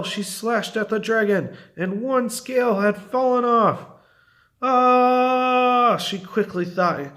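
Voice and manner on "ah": high, loud drawn-out "ah!"; cry of alarm. A narrator's reading. Witch falling from her broom, alarmed cry. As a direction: yelp as you tumble through the air.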